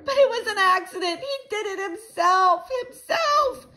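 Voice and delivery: high, loud wailing protest. A narrator's reading. Girl blaming the little boy, crying out. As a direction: wail the excuse, climbing to a shriek. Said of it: "Sheila cried louder".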